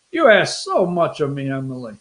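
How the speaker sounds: mock put-upon, dropping low; joking complaint. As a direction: feign being overburdened. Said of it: "I'm just joking".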